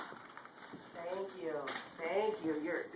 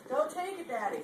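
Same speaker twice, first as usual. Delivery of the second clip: voicing the toddler; 4 semitones higher than usual. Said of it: "She said".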